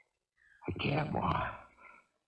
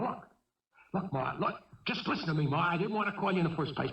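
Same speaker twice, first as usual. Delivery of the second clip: ordinary talk, nothing marked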